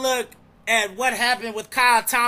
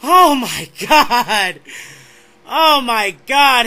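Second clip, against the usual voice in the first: slow, awed disbelief with a laugh; breathless astonishment; stunned; 3 semitones higher, 9 dB louder, much slower than usual. Real, not acted. Stunned reaction after photo finish.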